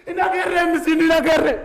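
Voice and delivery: high, impassioned protest; emphatic denial. Acted. Poet insisting on not having seen, high and emphatic. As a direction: lift the whole line high, insistently.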